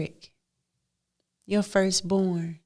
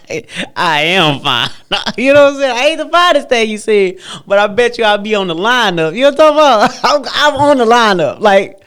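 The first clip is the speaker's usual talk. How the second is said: louder, higher, laughing brag; laughing loud boast; 3 semitones higher, 13 dB louder than usual. Real, not acted. Woman loudly bragging she is on the lineup.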